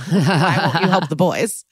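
talking through laughter; laughing. Real, not acted. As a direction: laugh your way through a joking question. Joking, laughing at own question.

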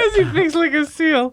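laughing through the line; giggling while talking. Real, not acted. Repeats joke laughing.

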